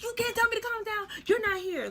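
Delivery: high-pitched, hysterical retold outburst; blacking out on him. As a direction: lash out at boyfriend through mock tears. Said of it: "I'm blacking on everybody".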